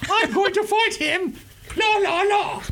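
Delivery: very high, hammed-up British accent; irate British caller. British listener wanting to fight John, mock British accent. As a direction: squawk it as a silly fighting Brit. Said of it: "this guy is gonna be listening".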